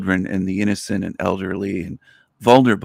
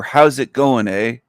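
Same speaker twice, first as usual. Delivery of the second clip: Canadian greeting with eh; 2 semitones higher, 4 dB louder than usual. Shown as a demonstration. Showing viewers Canadian speech, mock Canadian eh. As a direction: lean into the Canadian eh. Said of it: "Canadians say it is".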